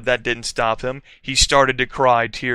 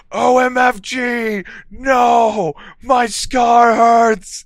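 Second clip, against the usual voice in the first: high, loud, drawn-out wail; screaming; 11 semitones higher, 7 dB louder, slower than usual. A narrator's reading. Vampire screaming as his scar hurts, loud high scream. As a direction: scream in pain, stretching the last words. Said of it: "he started to scream".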